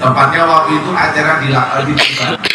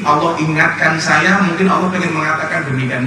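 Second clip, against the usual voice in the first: ordinary talk, nothing marked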